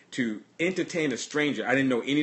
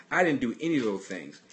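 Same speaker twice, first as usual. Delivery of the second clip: usual speaking voice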